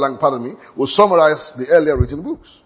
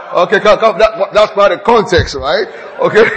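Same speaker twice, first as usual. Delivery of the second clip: higher, much louder, still laughing; laughing through words; 4 semitones higher, 8 dB louder than usual. Real, not acted. Preacher reining the congregation back in, laughing and loud. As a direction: push on while the laugh is in you.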